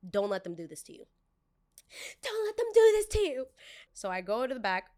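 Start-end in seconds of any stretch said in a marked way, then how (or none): 2.2-3.5 squeaky high mocking repeat. Mocking the boss's warning in a squeaky high voice. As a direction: pitch it way up, louder, mocking.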